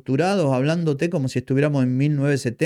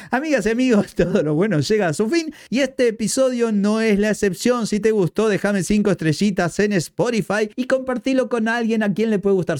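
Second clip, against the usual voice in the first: higher, bright host sign-off voice; podcast outro; 7 semitones higher than usual. Acted. Host signing off to listeners, upbeat announcer read. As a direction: wrap up the episode like a radio host.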